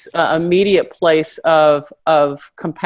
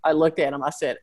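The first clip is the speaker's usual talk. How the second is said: ordinary talk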